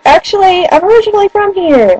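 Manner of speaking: high-pitched put-on British accent; retold confession in a light British accent. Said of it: "I was using my British accent".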